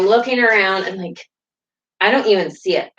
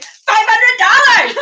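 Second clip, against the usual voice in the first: high, slow laughing cry; laughing shout to strangers; joyful shout; 6 dB louder, slower than usual. Real, not acted. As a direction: call it out giddy with joy. Jokingly shouts the prize.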